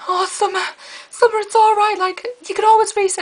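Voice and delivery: high, sugary mock sympathy; pitying classmates. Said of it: "people were coming up going".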